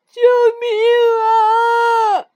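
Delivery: a slow, wailing cry of terror; terrified cry for help. Acted. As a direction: shriek for help in free fall. Falling fast through the air.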